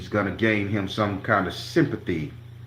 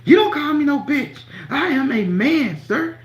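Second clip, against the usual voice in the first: sweet high voice; 4 dB louder than usual. Retold or imitated. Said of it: "Voice got real sweet".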